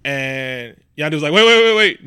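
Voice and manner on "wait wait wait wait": loud, higher retold "hold on"; urgent interjection. Retelling a movie scene to co-host, urgent interruption. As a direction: cut in sharply, pitched up and louder.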